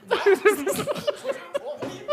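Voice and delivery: real giggling fit through the words; laughing. Real, not acted. Guest teased by co-hosts, laughing too hard to talk. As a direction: barely get it out for laughing. Teased about the missing headphones.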